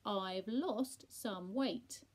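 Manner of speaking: very slow and loud, word by word; over-enunciate for a deaf listener; laboured repeat. A narrator's reading. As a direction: repeat it with LOST drawn out.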